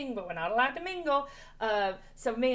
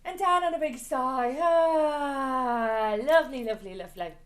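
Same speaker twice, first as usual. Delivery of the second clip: big sigh; 7 dB louder, slower than usual. Shown as a demonstration. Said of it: "we're gonna take a nice breath in".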